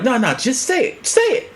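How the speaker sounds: high-pitched, insistent dare; imitated goading, pitched well up; Delroy Lindo daring. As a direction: egg him on in a high voice. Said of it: "looked at him with a very intense stare".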